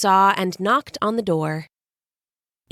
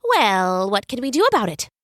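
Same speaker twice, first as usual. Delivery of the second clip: fast, words tumbling together; speedy Hermes. Acted. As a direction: rush it like a messenger in a hurry. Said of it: "even his words ran together".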